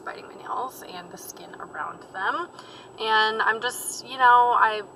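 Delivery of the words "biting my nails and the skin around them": soft embarrassed whisper; hushed confession; whispered admission. Real, not acted. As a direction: breathe it out quietly, a little ashamed. Quiet admission about nail biting.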